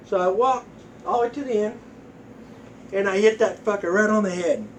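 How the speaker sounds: ordinary talk, nothing marked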